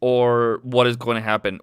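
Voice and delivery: ordinary delivery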